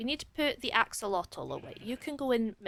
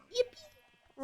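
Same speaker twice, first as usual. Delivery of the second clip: excited cheer; 5 dB quieter than usual. Real, not acted. Gleeful cheer.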